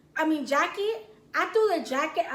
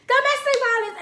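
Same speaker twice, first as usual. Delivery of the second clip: high, loud mock scream; retold screaming; 9 semitones higher, 7 dB louder than usual. Drunk girlfriend accusing boyfriend, mock screaming. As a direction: cry out an accusation theatrically. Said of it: "I just started screaming".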